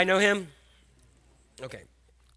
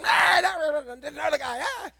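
cussing; 7 semitones higher than usual. Retold or imitated. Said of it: "He starts cussing".

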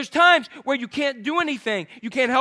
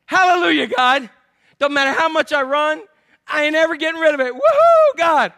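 loud, raised praise building to a whoop; mock rejoicing; 4 semitones higher, 7 dB louder than usual. Preacher praises God for love handles, loud mock joy. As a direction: celebrate the flaw at full voice.

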